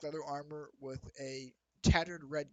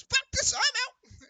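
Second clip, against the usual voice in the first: high, loud panicked bird-man voice; panicked squawk; 12 dB louder, slower than usual. Acted. Fleeing Kenku bails out, high loud panic. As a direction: bolt in a shrill panic. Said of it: "And he goes".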